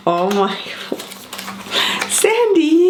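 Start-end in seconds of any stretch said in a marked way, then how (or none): 2.1-2.9 touched. Real, not acted. Thanking the sender, touched.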